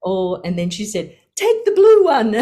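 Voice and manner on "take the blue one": high, loud, laughing order; laughing command. Retold or imitated. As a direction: laugh it out as a cheerful decree. Guru laughing, choosing sari.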